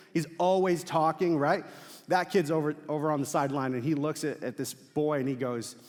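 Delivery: usual speaking voice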